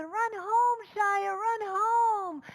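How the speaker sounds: very high, loud, stretched shout; drawn-out cheering yell; crowd shouting. Retold or imitated. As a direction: scream him all the way home. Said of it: "they're screaming".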